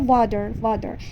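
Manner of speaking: "water" in American English, T softened to a D; American accent. Shown as a demonstration. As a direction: say "water" like an American, "wah-der". («water» با لهجهٔ آمریکایی)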